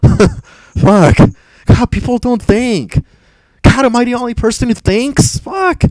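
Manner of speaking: very high-pitched, incredulous exasperation; exasperated rant. Real, not acted. Streamer despairing that nobody in chat thinks, exasperated. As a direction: cry out in disbelief, voice shooting far up. Ranting that people don't think.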